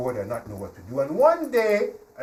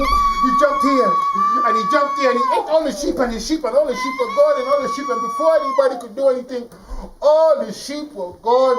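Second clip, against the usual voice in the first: loud, high, breathless-paced climax of the tale; storyteller swept up in the action; frantic narration; 10 semitones higher, 9 dB louder than usual. A narrator's reading. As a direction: tell the wolf attack at full pitch. Wolf attack narrated at climax.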